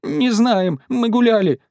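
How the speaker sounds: put-on high, tearful little-girl voice; sobbing child. A narrator's reading. Катя explains they were out walking, sobbing. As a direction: answer in a high whimper. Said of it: "всхлипнула Катя".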